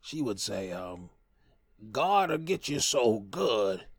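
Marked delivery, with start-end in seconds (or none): 1.9-3.8 slow, slightly higher grandmother voice; mild imitation of great grandma. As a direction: drag it out like an old grandma. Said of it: "I'll try to imitate her voice".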